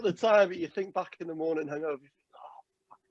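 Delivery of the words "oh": ordinary talk, nothing marked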